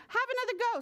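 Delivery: high-pitched friendly dog voice; encouraging dog. A narrator's reading. Dog to little bird after a wrong try, high and encouraging. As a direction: lift the voice high to urge another try. Dog's line in story.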